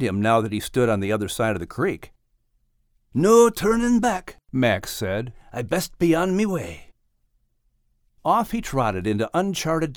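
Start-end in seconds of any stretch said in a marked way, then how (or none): 3.1-4.4 hearty Scottish brogue, boastful; proud little Scottish dog. Acted. Max resolute, slow. 5.5-6.8 hearty Scottish brogue, boastful; proud little Scottish dog. Acted. Max's voice.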